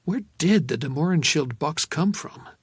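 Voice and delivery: ordinary delivery, nothing marked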